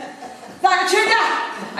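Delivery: high, drawn-out Coatbridge caller; Coatbridge accent. Retold or imitated. Lady caller phoning the Tudor Hotel, broad Coatbridge accent. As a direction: imitate a Coatbridge woman, stretched and high. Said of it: "in her best Coatbridge accent".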